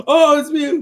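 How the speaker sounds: high excited gasp of surprise; surprised exclamation. Retold or imitated. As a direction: act stunned to see Mew. Acted surprised character line.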